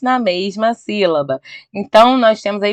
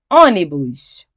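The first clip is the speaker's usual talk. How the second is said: ordinary talk, nothing marked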